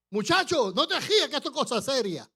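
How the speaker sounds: high, loud scolding shout; loud stern warning to a boy; stern rebuke. Retold or imitated. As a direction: rebuke a laughing young man sharply. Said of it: "Así me dice".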